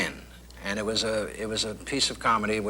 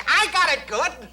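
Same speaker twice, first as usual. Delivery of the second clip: much higher and louder, clipped detective voice; the sound man reports back, caught by the voice; sound man in Smart voice; 7 dB louder than usual. Retold or imitated. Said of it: "everybody was talking like that".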